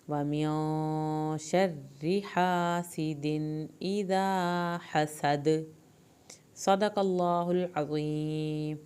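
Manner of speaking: slow, drawn-out melodic Quran recitation; Quranic recitation. Shown as a demonstration. Tajweed teacher reciting the verse for her students, slow and melodic. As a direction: recite each word with care, stretching the vowels. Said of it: "دال کا کلکلا کریں".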